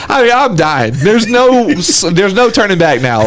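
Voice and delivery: ordinary talk, nothing marked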